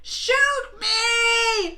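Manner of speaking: high, drawn-out mock shriek; imitated screaming. Demonstrating what the neighbour sounds like, mock scream. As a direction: wail each word loudly, as she did. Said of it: "this is what it sounds like".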